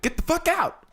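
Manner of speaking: high barked threat; gunman yell. Acted. Gunman yells at the couple in a high mock shout. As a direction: shriek it like a robber at the car window.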